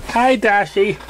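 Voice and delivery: perky Pinkie voice. Acted. Pinkie popping up behind the haystack, bouncy. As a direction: chirp it like a giddy pony. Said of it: "said Pinkie, sudden bounce".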